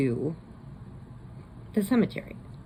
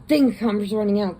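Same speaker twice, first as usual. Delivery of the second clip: usual speaking voice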